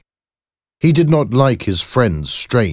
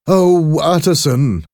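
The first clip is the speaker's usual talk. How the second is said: high, loud, anguished exclamation; exclaiming; 11 semitones higher, 7 dB louder, slower than usual. A narrator's reading. Doctor exclaims to his lawyer after the escape, distraught and loud. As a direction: cry out to a friend, pitch right up. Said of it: "exclaimed Doctor Jekyll".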